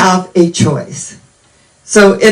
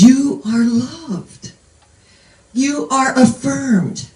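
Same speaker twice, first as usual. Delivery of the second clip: tender affirmation; 5 semitones higher, 4 dB quieter, slower than usual. Acted. Inner self speaking loving affirmation.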